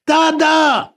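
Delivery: insistent high, loud call for daddy; child's shout. Retold or imitated. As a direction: shout for Dada like a little kid. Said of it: "so I shouted for him again".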